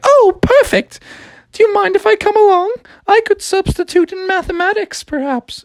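high, breathy-free lilting princess. Acted. Princess telling Froggy about her sisters, high voice. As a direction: play the upset princess in a high voice. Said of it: "She's like".

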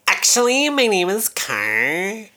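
mocking, squeaky high correction; high snooty mimic. Friend mimics the girl, high and snooty. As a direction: play her as prissy and high. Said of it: "Did she say it like that?".

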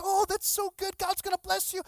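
high, gushing praise; proud papa. Retold or imitated. Pastor praises the man who tithed, proud papa gushing. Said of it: "just like a proud papa".

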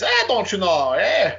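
put-on Minnesota accent. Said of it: "You know, in Minnesota".

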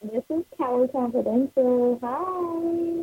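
muffled phone line, echoing like a tunnel. Real, not acted. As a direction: caller breaking up on a bad line. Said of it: "It sounds like you're in a tunnel".

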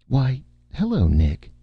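high, smiling greeting that drops on the name; bright smile. A narrator's reading. DeeDee spotting Nick behind the curtains, brightly smiling. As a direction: greet them with an audible smile. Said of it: "DeeDee said with a bright smile".

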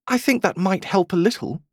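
high, tentative little voice; timid. A narrator's reading. Alice hoping the fawn can help, timidly. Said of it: "she said timidly".

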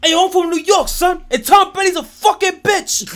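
high, loud, laughing mock yell; mock Jets fan on the arena mic; imitating the fan. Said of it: "the first thing you wanna say over a mic".

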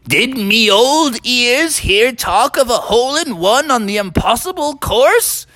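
put-on high-pitched old rhinoceros voice; old wise rhinoceros. Old rhinoceros asking golfers about the feat, wondering. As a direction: play a curious old storybook creature. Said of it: "the old wise rhinoceros".

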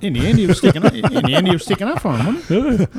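high loud laughing; laughing and excited. Real, not acted. Laughing excited Terminator point.

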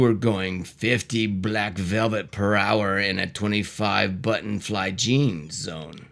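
low, slow cop voice; deep officer voice. Acted. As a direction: play the stern traffic cop low. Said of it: "Do different voices".

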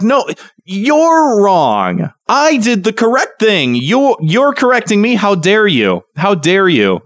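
wag a finger and play it indignant; mock-indignant commenter scolding a corrector; mock outrage. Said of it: "it's just like".

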